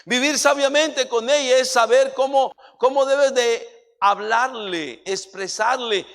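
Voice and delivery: loud, pounding preacher's delivery; loud sermon climax; full-force preaching. Acted. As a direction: drive each line home to the congregation.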